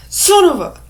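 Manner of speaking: high, loud cut-off curse; shouted mock fury; acted angry outburst. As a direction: explode with anger, stopping short of the swear. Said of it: "I'm using anger".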